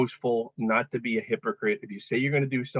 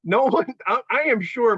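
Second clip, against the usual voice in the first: higher, louder indignant protest; mock protest; 11 semitones higher, 6 dB louder than usual. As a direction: cry out in mock offence. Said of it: "I'm like".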